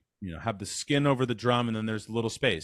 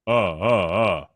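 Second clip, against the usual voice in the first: low, louder chest grunts; caveman grunts; 5 semitones lower, 4 dB louder than usual. Shown as a demonstration. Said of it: "that sort of caveman".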